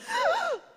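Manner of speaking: sharp high gasp of fright; sudden frightened intake of breath; startled gasp. Retold or imitated. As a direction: gasp as if your heart stopped. Kid darts into the street.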